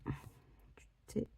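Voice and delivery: whisper. Real, not acted. Scratching ticket, murmuring to self.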